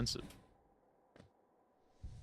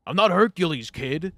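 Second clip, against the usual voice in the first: put-on gruff old customer bellowing, higher and louder; barking like a cranky old man at the cashier; grumpy old man; 6 semitones higher, 10 dB louder than usual. Old man scolding the young cashier, loud and gruff.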